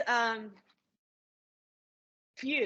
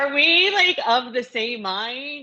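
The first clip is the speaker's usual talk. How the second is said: much louder, higher and slowed, overjoyed; gushing fan; 5 semitones higher, 12 dB louder, slower than usual. Retold or imitated. Fan gushing to Ryan Murphy about the new season, excited and loud. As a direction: gush at him like a thrilled superfan.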